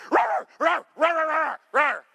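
high mock "roar" barks imitating a dog; dog barking. Pastor imitates his dog barking at the UPS truck, high mock barks. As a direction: bark it out like a yappy dog, high. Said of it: "Xena's on going".

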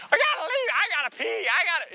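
high mock whine, making excuses to leave; hammed-up whiny excuses. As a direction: whine like a fidgety churchgoer.

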